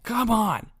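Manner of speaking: high, whiny mock scolding; mock exasperation. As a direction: imitate a fed-up boss. Villain scolded by the president.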